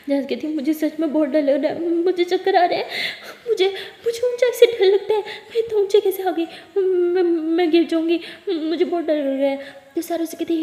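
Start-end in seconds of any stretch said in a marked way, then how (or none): 0.6-9.8 higher, louder, shaky with nervous laughs; half laughing, half crying in panic; panicking. A narrator's reading. Jess begs Sara not to let her fall, panicking. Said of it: "जैस बहुत ही ज़्यादा panic कर रही होती है".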